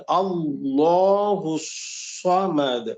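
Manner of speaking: slow, measured recitation of اللہ الصمد. Shown as a demonstration. Teacher reciting the verse for the student. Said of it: "پھر دوبارہ اس کو پڑھیں گے".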